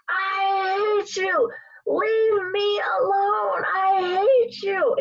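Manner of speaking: high, drawn-out mock scream; imitated scream. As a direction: imitate an outburst, each word stretched slow and high. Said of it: "screams at the top of her lungs".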